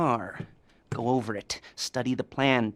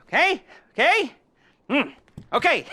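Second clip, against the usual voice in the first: high, loud pleading okay; squeaky insistence; 13 semitones higher, 5 dB louder than usual. Acted. Lester presses the player, high-pitched and loud. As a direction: push for agreement, much higher.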